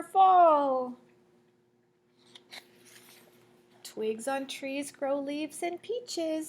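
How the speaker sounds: lively, rising read of the rhyme, fading at the end; reading to children. Acted. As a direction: build up the list, then settle to sleep. Reads picture book aloud.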